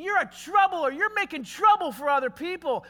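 accusing. Retold or imitated. Accusers brand the preacher a troubler.

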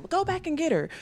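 high, urgent order; commanding stepmom. Retold or imitated. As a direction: send them back at once. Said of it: "she was like".

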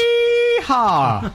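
high, hammed-up cowboy whoop; cowboy yee-haw. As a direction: holler it like a rodeo cowboy. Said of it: "That's how you greeted us".

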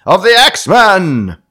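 "X-Men" stretched, high and loud, sounding like the superheroes; X-Men slip. Acted. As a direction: drag "X-Men" out big, like the comic title. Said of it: "That sounded like X-Men, and I didn't mean it to".

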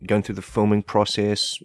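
ordinary talk, nothing marked

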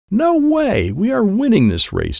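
very high, excited yell; screaming back. Acted. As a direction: shout back defiantly, high-pitched. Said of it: "Dad screamed back".

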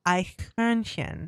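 slow, deliberate German accent; drawn-out German pronunciation for a puzzled listener. Shown as a demonstration. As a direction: repeat it slowly in a German accent. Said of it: "With your best German accent".

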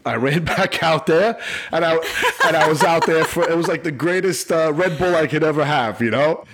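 real laughter lifting the pitch high; laughing. Real, not acted. Officer joking about his energy boost, laughing.

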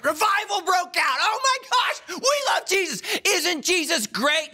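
high-pitched hammed-up shouting; mock ecstatic revival. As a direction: whip up fake church euphoria, wailing with joy. Mock congregation erupting in revival.

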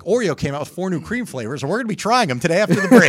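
peppy, rising morning-TV patter; morning show host. Retold or imitated. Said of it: "they would have segments like".

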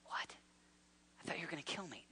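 soft, stunned whisper; hushed disbelief. Acted. Condemned man learning he is spared, whispered and stunned. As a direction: breathe it out in disbelief.

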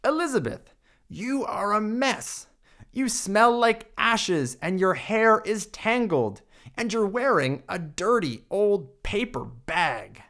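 high, sniffy scolding; snooty prince. Acted. Prince scolds the dirty princess, high and snooty. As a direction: look down your nose at her. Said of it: "He looked at her and said".

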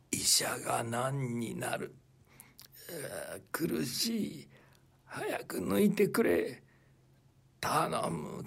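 strained, breathless whisper, words forced out slowly; pained whisper. Acted. Dying brother begging his elder brother to pull the razor, pained whisper.

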